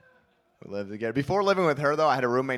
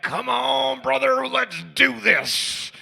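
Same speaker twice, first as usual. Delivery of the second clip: slow high wrestler shout; booming wrestling promo call; hyped wrestler yell; 10 semitones higher, 5 dB louder, much slower than usual. Retold or imitated. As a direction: pump yourself up like Hulk Hogan. Pumping himself up like Hulk Hogan.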